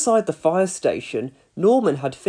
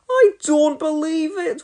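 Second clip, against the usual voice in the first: high, slow wail of dismay; 11 semitones higher than usual. A narrator's reading. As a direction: wail over burnt food. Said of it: "wailed Elvis".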